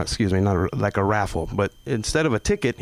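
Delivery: ordinary talk, nothing marked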